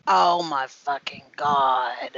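low, drawn-out swoon; slow, low and overcome with admiration. Real, not acted. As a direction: savour it slowly, low in the voice. Swooning over a favourite audiobook narrator.

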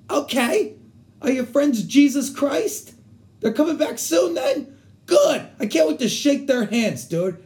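high, mounting mock outrage; riled-up retort. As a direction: throw it back high and indignant, acting riled. Acted retort to a provocation.

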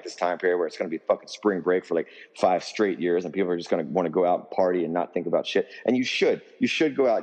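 ordinary talk, nothing marked

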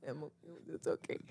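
tiny, soft choked voice; imitating tearful stifled laughter. Laughing teen reassuring the woken lady, choked and very soft. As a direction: barely get it out, holding it in. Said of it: "I'm dying in tears".

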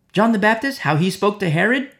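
usual speaking voice

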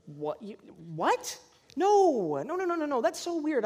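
high-pitched incredulous refusal, replayed; mock-startled objection; baffled protest. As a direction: balk in disbelief, pitch leaping on the no. Acted baffled refusal to God's request.

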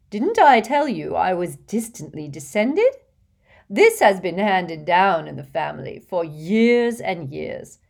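put-on fussy, gushing rich old lady, laughing in; a vain old witch showing off to a charming boy; giggly hostess voice. Hepzibah boasting of heirloom.

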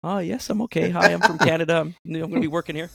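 high, polite newcomer voice; a lightly mocked polite Canadian; mimicking himself. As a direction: play the timid new guy. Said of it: "I go".

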